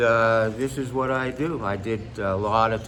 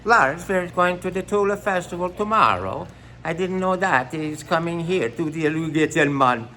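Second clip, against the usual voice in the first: higher-pitched comic Swedish accent; mock Swedish accent; 4 semitones higher than usual. Shown as a demonstration. Comedian shows off his voices, mock Swedish accent. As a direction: put on a lilting Swedish accent. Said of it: "Swedish would be".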